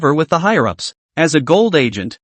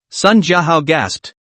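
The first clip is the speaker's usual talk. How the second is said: plain reading voice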